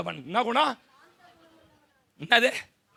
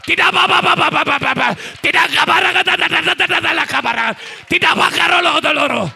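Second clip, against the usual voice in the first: shouted high prophetic preaching with tongues; preaching at full force; 8 semitones higher, 15 dB louder, slower than usual. Acted. Pastor shouting prophecy over the congregation in full force. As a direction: cry out the prophecy over the church.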